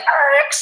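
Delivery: imitated fed-up husband's grunt; frustrated groan. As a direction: let out a burst of annoyance. Husband groans, pulls over.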